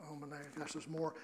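hushed retold dismay. Preacher retelling his reaction to the Holy Spirit, hushed aside. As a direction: drop to a soft, low aside.

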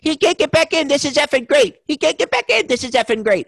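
high, loud mock glee; Joe Pesci. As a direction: impersonate a man crowing with delight at someone's predicament. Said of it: "And Pesci goes".

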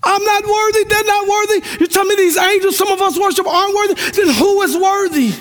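high, climbing preacher's cry; preaching at full force. Acted. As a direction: lift it up like a sermon climax.

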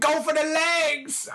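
a high, slow put-on villain voice; lightly hammed-up villain voice; film villain line. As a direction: bark it like the movie villain.

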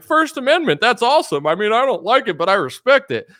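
very high, loud breezy approval; mock fan approval. Fans shrugging off a blasphemous cartoon, high loud cheery mock voice.

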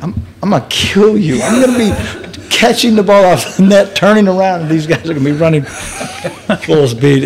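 high, worked-up comic complaint; exasperated dread, played for laughs; retold panicked protest. Acting out panicked protest to brother.

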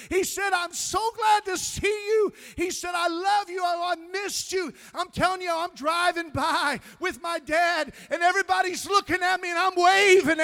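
very high, very loud sustained pulpit shout; fervent revival climax at full voice; full-force preaching. Real, not acted. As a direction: shout each phrase out to the whole church.

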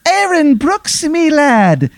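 very high, drawn-out mock-Irish lilt; Easy Irish. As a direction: trill it slowly in a terrible Irish accent. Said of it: "this terrible Irish accent".

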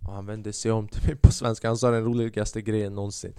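ordinary delivery

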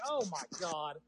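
ordinary talk, nothing marked

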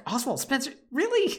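mock disbelief. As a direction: act out Chris as unimpressed and incredulous. Chris incredulous at Spencer's notes.

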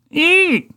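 tiny high-pitched squeal; shriek. Acted. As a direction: squeal like a startled mouse. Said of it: "let out a shriek".

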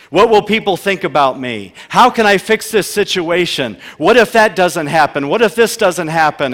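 loud, driving sermon voice; preaching at full force. Acted. Pastor preaching loudly to his congregation on anxiety.

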